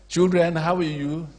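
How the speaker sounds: slow, lilting class greeting; greeting children. Retold or imitated. Greets primary one pupils.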